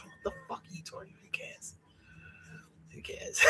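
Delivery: whispered, exasperated who cares; hushed retort. Retold or imitated. Telling the one-upping housemate off, in a hushed whisper. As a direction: breathe it out almost unvoiced, fed up.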